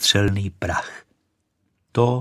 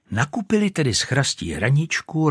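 plain reading voice

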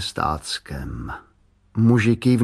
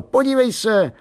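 put-on boy calling out high to his mother; high, eager child's shout; excited calling; 13 semitones higher, 3 dB louder than usual. A narrator's reading. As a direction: call for attention at a much higher pitch.

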